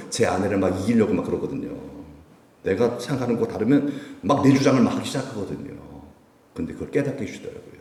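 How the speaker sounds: usual speaking voice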